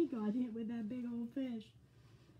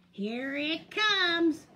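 slow, loud, rising build-up; drawn-out warning; 6 semitones higher, 9 dB louder, much slower than usual. A narrator's reading. Skunk about to smack Bear, slow and loud. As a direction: stretch it out loudly before the swing.

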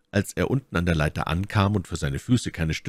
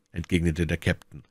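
ordinary delivery, nothing marked

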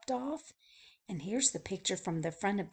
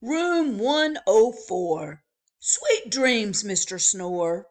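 put-on chirpy old-fashioned bellhop; 2 semitones higher, 10 dB louder, slower than usual. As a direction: call out the welcome like a grand hotel porter. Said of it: "said the bellhop".

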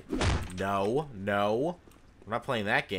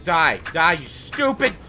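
furious screaming half in tears; angry crying; 4 semitones higher, 8 dB louder than usual. Real, not acted. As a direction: yell it in frustrated rage, near tears. Furious rage at game enemies.

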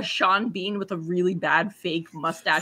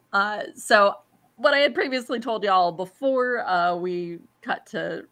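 usual speaking voice

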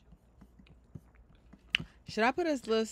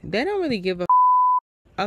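much higher, louder exasperated cry; exasperated outburst; 10 semitones higher, 7 dB louder than usual. Real, not acted. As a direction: burst out at being ignored. Outburst at being ignored.

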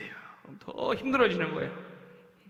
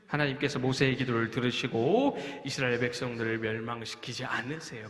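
normal talking voice

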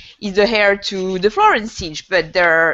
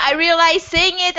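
normal talking voice

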